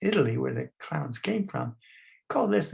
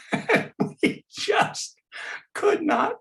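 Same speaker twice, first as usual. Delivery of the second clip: squeezed out through real laughter; barely getting words out for laughing; high breathless laughing words; slower than usual. Real, not acted. Laughing so hard recalling it.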